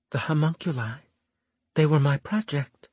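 lightly voiced Florimel. Florimel's voice in narration.

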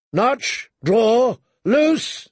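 high, ringing one-word orders; battle drill shouted at archers; shouted commands. A narrator's reading. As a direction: call each order out, last one highest.

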